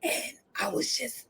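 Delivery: barely audible voiceless whisper; losing voice. Shown as a demonstration. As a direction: let the voice vanish to almost nothing. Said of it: "getting hoarse".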